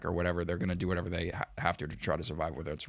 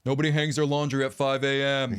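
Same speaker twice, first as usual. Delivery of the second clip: loud, higher mock scolding; mock yelling; 5 semitones higher, 5 dB louder than usual. Scolding a nosy neighbor at dawn, mock yelling. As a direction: holler playfully across at the neighbor. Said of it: "I can just yell at her".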